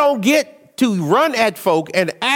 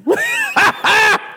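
high shouted laugh; 14 semitones higher than usual. Real, not acted. Laughs at wife's correction.

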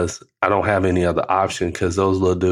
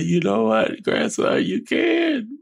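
very high, choked-up reply; high strained voice; 14 semitones higher, slower than usual. Retold or imitated. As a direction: squeeze it out high and tight. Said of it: "I said".

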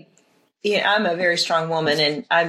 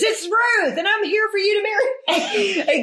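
very high, gushing announcement; mock bold Ruth. Joking how Ruth didn't announce herself, high mock gushing. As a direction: pop up high and giggly, hammed-up. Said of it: "Ruth pops up and says".

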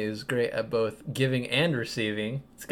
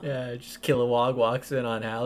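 normal talking voice

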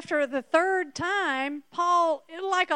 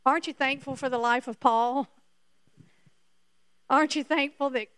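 ordinary talk, nothing marked

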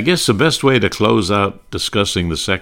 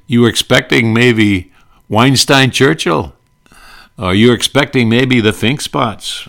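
mild Yiddish-inflected retort, higher and louder; 5 semitones higher, 6 dB louder than usual. Retold or imitated.

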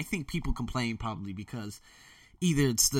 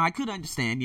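ordinary talk

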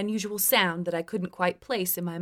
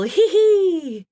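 put-on loud, high drunken giggle; gleeful hee hee; 10 semitones higher, 9 dB louder than usual. A narrator's reading. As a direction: let out a gleeful little cackle. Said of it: "letting out a gleeful".

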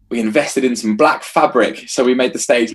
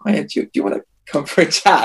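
mock official; 7 semitones higher, faster than usual. Voicing the local authorities.

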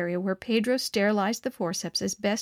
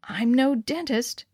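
usual speaking voice

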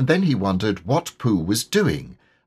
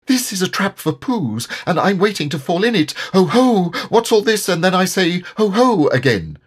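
fast, much higher, squeaky babble; quick squeaky babble, louder on ho ho; quick squeaky Piglet; 10 semitones higher, 4 dB louder than usual. A narrator's reading. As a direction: rattle it off in a panic, squeaking. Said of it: "he said very quickly and squeakily".

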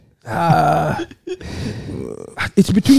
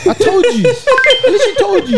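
gloating; 13 semitones higher than usual. Real, not acted. Gloating over the result.

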